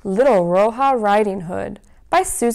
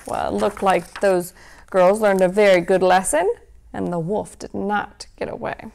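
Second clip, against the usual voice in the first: ordinary delivery, nothing marked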